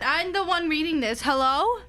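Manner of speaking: normal talking voice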